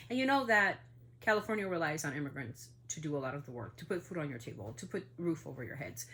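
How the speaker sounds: normal talking voice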